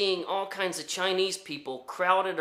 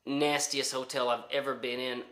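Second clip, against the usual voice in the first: ordinary delivery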